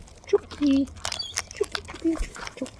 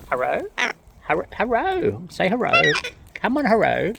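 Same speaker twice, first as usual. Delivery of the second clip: higher, louder sing-song hello for a bird; high coaxing hello; 6 semitones higher, 4 dB louder than usual. Real, not acted. Asking the bird for a rare hello, high coaxing.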